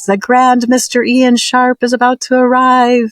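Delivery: high, ringing herald's announcement; heavenly announcer proclaiming an arrival; grand announcement. Retold or imitated. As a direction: announce him like a royal herald. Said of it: "I heard an announcement".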